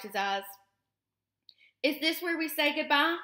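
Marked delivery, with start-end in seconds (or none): 1.8-3.2 put-on high young gosling voice, rising on "goodbye"; little one asking mother, pitched up; gosling's question. A narrator's reading. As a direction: ask it high and uncertain, lifting at the end. Gosling asks robot mother about parting.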